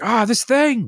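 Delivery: high, sharp burst of frustration at a toy; frustrated outburst. Retold or imitated. Wife losing patience with the basketball net, frustrated yell. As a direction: snap at the broken net. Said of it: "got mad".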